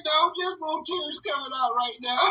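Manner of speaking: high, soft tearful denial; sheepish denial. Retold or imitated. Patient denying tears to assistant, high sheepish voice. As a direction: play it off while teary. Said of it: "I said".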